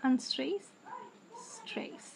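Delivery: usual speaking voice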